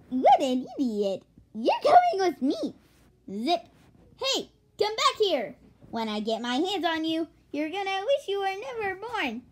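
scolding with high-pitched bursts; hammed-up anger. Guard chases the escaping intruder, hammed-up anger. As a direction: threaten the runaway in cartoonish anger.

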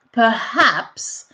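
"Perhaps" with a clearly breathed H in the middle, per-HAPS; teacher models "Perhaps", pushing air on the H; H sounded. Shown as a demonstration. Teacher showing students how to say "Perhaps" with the H. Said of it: "n'oubliez pas le H au milieu, il faut le prononcer".

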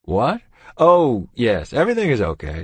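doctor surprised mid-scan; startled. Acted. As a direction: caught off guard, loud and high.

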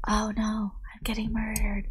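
whispered pretend victim; mock ASMR whisper. Imagining a horror game played as ASMR, mock panicked whisper. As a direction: whisper a make-believe cry for help. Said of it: "Phasma just being like".

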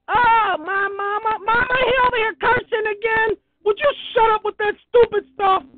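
put-on a kid telling on him to mama; tattling child.

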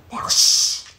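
hushed shh under the breath; whispered hush to start the story; whispered shush. Acted. Hushing her listener before story.

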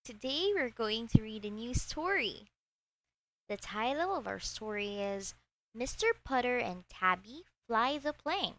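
usual speaking voice

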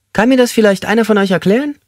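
high, squeaky, exasperated man; squeaky inmate grumbling about his wife. A narrator's reading. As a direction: squeak out the complaint in disbelief. Squeaky inmate asks the group.

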